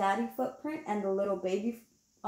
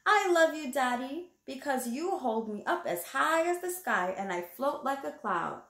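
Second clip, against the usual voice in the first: put-on reading to children; 4 semitones higher than usual. Reading the picture book aloud.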